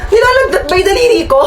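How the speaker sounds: high-pitched wailing protest; incredulous outburst. Acted. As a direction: cry out in disbelief. Outraged at useless clinic remedy.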